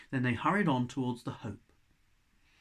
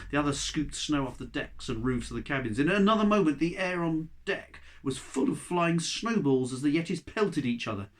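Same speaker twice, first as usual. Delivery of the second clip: plain reading voice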